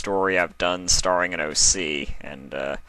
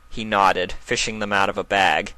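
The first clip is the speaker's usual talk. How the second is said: ordinary talk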